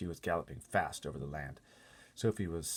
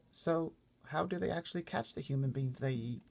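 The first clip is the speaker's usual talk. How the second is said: put-on higher, small girl voice; little girl Sophie; 10 semitones higher than usual. Sophie asking the giant about the other giants, small high girl voice. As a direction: speak up like a worried little girl.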